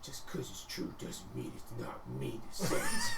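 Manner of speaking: slow, low grumble; low ogre grumbling. A narrator's reading. The GM voicing an ogre grumbling at the troll, low and slow. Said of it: "an ogre just this low grumbling".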